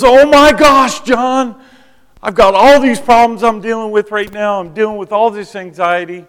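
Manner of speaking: high, loud, frantic woman on the phone; imitating Angelica spilling her problems; upset woman's voice. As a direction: pitch way up as a distraught young woman. Voicing distressed Angelica on phone.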